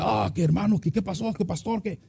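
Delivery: high, soft flustered muttering; mock fussing. Mimics flustered fussing at others. (refunfuño fingido, agudo y bajito)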